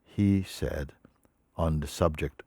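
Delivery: ordinary delivery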